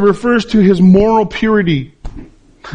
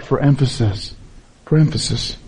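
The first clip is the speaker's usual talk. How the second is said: soft emphasis; 5 semitones lower, 6 dB quieter than usual. Real, not acted. Sermon point repeated softly.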